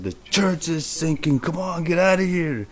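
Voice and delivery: high, frantic warning to flee; panicked warning. Retold or imitated. Dreamer warns partygoers the church is sinking, high and frantic. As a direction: warn them the building is going down.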